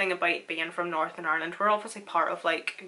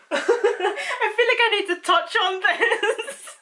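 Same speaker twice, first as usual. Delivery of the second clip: talking through giggles; amused, giggling aside; laughing; 13 semitones higher, 7 dB louder, slower than usual. Real, not acted. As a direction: laugh while you say it. Laughing about Scotland comments.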